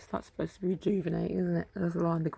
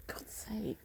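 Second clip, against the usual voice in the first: exasperated whisper; 4 semitones higher, 11 dB quieter than usual. Real, not acted. Exasperated aside.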